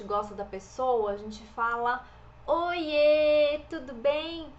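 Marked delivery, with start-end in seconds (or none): 2.5-4.5 loud, higher, delighted hello; drawn-out, happy "oiê"; happy affectionate greeting. Shown as a demonstration. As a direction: greet someone you are glad to see. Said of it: "É quando a gente tá feliz".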